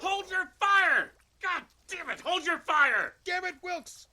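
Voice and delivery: high, slow bellow to stop the shooting; furious shout. Acted. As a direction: roar at the posse to cease fire. Shot fired, yelling at posse.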